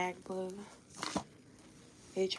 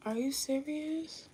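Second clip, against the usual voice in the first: disbelief; 8 semitones higher, 3 dB quieter, slower than usual. Real, not acted. Disbelief at the computer.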